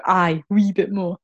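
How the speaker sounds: imitating the friend's Scottish accent; Scottish accent imitation. Friend mimics Lara's Scottish accent. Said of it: "You go".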